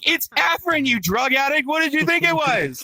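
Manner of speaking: high-pitched, indignant comeback; exasperated retort. Acted. Comic answering his buddy on the dance floor, exasperated. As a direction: scold him in mock outrage.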